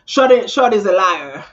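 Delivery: loud laughing accusation. Real, not acted. Laughing loudly to her viewers about the liar. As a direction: laugh it out in disbelief.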